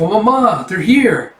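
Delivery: put-on high, loud excited kid voice; a little kid calling to his mum; excited child. Child voiced in comic reading.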